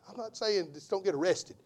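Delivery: ordinary talk, nothing marked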